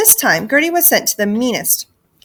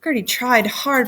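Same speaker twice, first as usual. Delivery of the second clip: ordinary delivery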